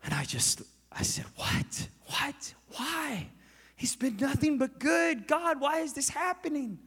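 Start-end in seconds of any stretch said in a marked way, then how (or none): 1.3-6.8 high, anguished pleading with God; high-pitched, stretched wail of disbelief; retold bawling. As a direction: re-enact crying out in grief, without full screaming. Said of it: "like, screaming, I'm crying".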